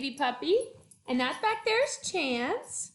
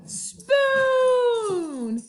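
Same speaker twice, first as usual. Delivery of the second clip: dramatic reveal; 8 semitones higher, 7 dB louder than usual. Acted. Dinosaur pulls out spoon.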